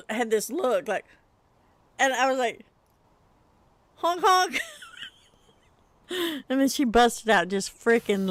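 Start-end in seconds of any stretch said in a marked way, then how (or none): 4.0-4.6 high goofy honk, honk; playful clown-horn honk; silly honk. Retold or imitated. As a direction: toot it like a bike horn. Repeats silly honk to daughter.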